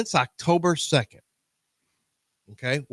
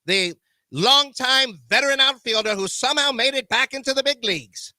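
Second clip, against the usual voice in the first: 1930s announcer voice; 4 semitones higher than usual. Acted. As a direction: hype the lineup like a 1930s ballpark broadcast. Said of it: "I'm gonna announce it in my nineteen thirties voice".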